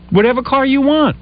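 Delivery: high-pitched fed-up pleading; worked-up retold protest; exasperated. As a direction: beg for a break, voice climbing. Retold argument with wife.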